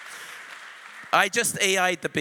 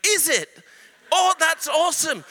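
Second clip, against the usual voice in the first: excited; 8 semitones higher, 5 dB louder than usual. Retold or imitated. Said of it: "And I was like".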